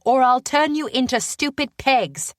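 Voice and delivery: high-pitched, energetic threat; feisty spell-slinger's threat; threatening young spellcaster. Acted.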